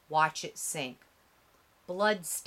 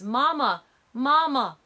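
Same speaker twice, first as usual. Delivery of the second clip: terrified higher, louder cry; screaming for mama; 6 semitones higher, 8 dB louder than usual. A narrator's reading. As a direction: scream for his mother in terror. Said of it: "Emmett screams".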